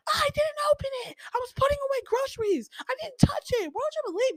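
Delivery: high, strained shriek at mom; real screaming tone. Retold or imitated. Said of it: "the tone that I'm using right now is quite literally an understatement".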